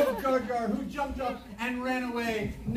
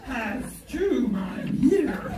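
Chewbacca growl; 6 semitones higher, slower than usual. Acted. Chewbacca voice in story.

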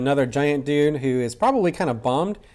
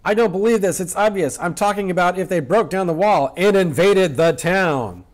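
raised voice; 4 semitones higher than usual. Acted. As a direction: act out indignant disbelief, swelling on the last words. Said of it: "don't suddenly raise your voice like that".

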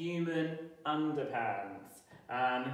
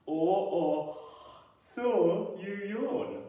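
yawning voice; 6 semitones higher, 4 dB louder, slower than usual. Shown as a demonstration. Phonics rhyme, slow yawn.

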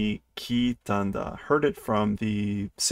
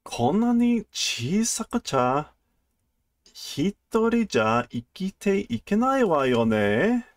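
higher, tender motherly voice; gentle mother cat voice; 8 semitones higher than usual. Acted. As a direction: soothe the little one gently.

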